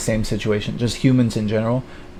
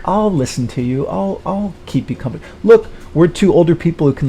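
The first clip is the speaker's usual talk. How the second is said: higher-pitched imitation of a coaxing woman; mock coaxing woman; 6 semitones higher than usual. Older woman offering the guy company, mock coaxing. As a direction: coax in a put-on higher voice.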